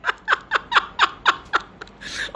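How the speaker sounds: breathy, very high laugh. Real, not acted. After telling off a Sidhu fan, a high laugh.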